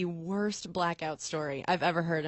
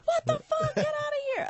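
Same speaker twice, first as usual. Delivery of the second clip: much higher shrieking mock girl; mock outraged girl. A girl waking mid-act, shrieking at the guy, much higher. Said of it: "she's like".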